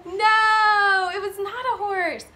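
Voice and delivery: high, drawn-out no; exaggerated storybook denial; emphatic no. A narrator's reading. Emphatic answer to the children.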